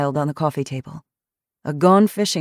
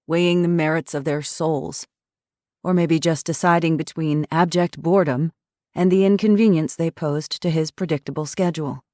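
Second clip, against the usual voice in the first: usual speaking voice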